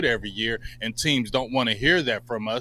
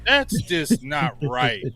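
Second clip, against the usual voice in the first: ordinary talk, nothing marked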